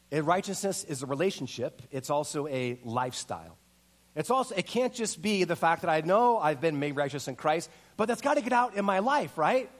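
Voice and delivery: ordinary talk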